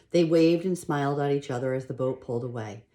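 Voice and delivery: plain reading voice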